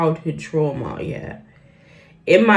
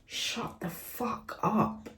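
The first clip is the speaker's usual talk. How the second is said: whispered retort; 5 semitones higher, 10 dB quieter, much slower than usual. Real, not acted. Retort to mother.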